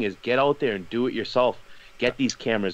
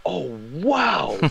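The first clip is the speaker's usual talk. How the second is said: higher, breathy wow of disbelief; amazed gasp; 7 semitones higher than usual. Retold or imitated. Boston hiker sees the city zoom in, amazed. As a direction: react like you can't believe your eyes. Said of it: "you can hear this guy go".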